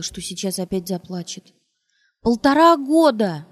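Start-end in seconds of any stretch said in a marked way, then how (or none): 2.2-3.5 loud, higher, slow cry with cracking voice; breaking cry. Acted. Wife crying out at husband, voice breaking. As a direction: shout it as the voice tears. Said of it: "закричала она рвущимся голосом".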